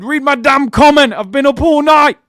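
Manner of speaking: loud, high-pitched mock outrage; imitated furious shout; mock angry viewer. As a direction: yell it like an exasperated fan. Acting out an angry viewer's comment.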